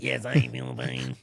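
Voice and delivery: stiff, even robot speech. Acted. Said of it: "You should have given him robot voice".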